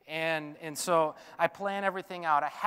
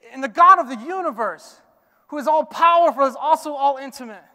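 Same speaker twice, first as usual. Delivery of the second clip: higher and louder, full pulpit force; passionate preaching; 7 semitones higher, 14 dB louder than usual. Acted. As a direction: proclaim it, voice soaring. Preacher's climax on God's power.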